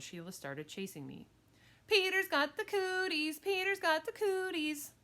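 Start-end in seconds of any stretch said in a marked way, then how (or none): 1.9-4.9 high, loud playground chant; high-pitched girl's mocking yell; yelled taunt. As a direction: tease him in a yelled chant. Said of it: "she yelled".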